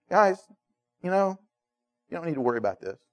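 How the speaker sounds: calm reassurance. Retold or imitated. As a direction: gently reassure them, barely above a murmur. Jesus calming frightened disciples.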